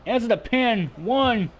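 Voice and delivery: rising excitement on the pin count; wrestling commentator. Acted. Near fall called in match.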